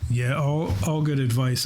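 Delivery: ordinary delivery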